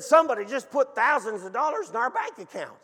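high, loud, astonished report; Loretta. Retold or imitated. Wife telling husband about surprise money, excited outburst. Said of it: "Loretta looks at her phone and screams".

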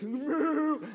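high, loud mock moo; cow moo. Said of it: "that cow was just gonna be like".

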